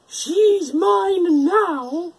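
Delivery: high, very slow, drawn-out witch voice; witch declaring. A narrator's reading. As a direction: gloat it out like a witch. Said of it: "the witch declared".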